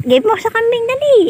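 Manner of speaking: high urging. Real, not acted. Urging baby to feed goat.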